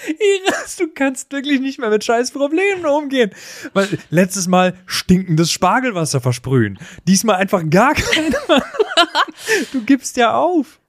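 giggling disbelief at a friend giving up; laughing while teasing. Real, not acted. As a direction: tease her while still cracking up. Teasing Iris through laughter.

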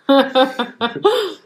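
big loud laugh, falling away; loud burst of laughter. Real, not acted. Friend laughs loudly at her own naked joke. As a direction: let a real laugh burst out.

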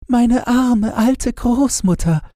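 put-on high little girl's voice, a touch sighing; sighing little Gretchen. Gretchen sighs about her grandmother, high girlish voice. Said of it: "seufzte Gretchen".

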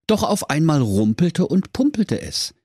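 ordinary delivery, nothing marked

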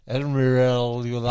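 slightly slurred tipsy speech; words blurring together a little; slurring. Real, not acted. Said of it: "Ich lalle heute ein bisschen".

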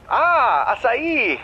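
cheerful. Pretend. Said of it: "lächle und sage fröhlich".

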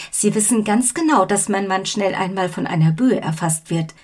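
ordinary delivery

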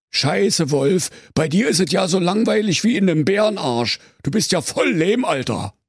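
mock the bored wolf; high-pitched slangy bear teen; cheeky teen bear.

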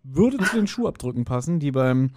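usual speaking voice

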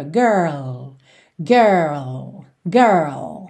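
put-on slow, low "Girl", curled R gliding into L; R then full L. Shown as a demonstration. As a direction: finish "Girl" with tongue on the palate for L. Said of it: "você faz o L".

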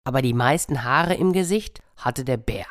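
ordinary delivery, nothing marked

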